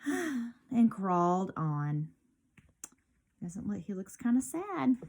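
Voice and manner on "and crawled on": storyteller's sigh, then a slow line; acted sigh, words very slow. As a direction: let out a sigh and drag the words. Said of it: "Squiggly only sighed".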